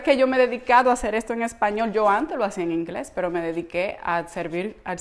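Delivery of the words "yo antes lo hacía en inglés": normal talking voice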